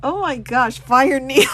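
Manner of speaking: ordinary talk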